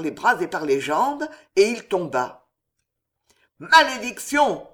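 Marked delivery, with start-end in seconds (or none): 3.6-4.7 loud, high howl; howled curse. A narrator's reading. Chevalier seized by armed men, howling. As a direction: howl the curse out, raised in pitch. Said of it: "hurla le chevalier".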